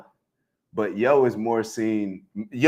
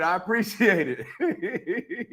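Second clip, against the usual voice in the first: laughing thanks; 7 semitones higher, 3 dB quieter, much slower than usual. Real, not acted. Laughing thanks after a shout-out.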